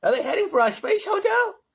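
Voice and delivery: very high, loud alarm; alarmed controller, squeezed high; panicked question. A narrator's reading. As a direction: ask in rising panic. Ground control panics over hotel.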